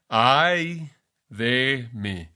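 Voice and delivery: slow, drawn-out low lament "Ay de mí". Acted. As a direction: let "Ay de mí" sink out slowly in woe. Preacher voices Isaiah's lament.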